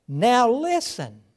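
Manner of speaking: "Now listen." slow, loud and weighty, stress on "now"; slow emphatic call. Shown as a demonstration. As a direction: drop on "listen" like a call to attention. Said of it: "I like that".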